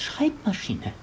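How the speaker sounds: whispered disbelieving echo; incredulous whisper. Acted. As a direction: breathe the word in disbelief.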